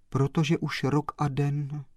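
ordinary delivery, nothing marked

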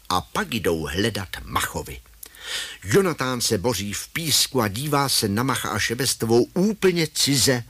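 plain reading voice

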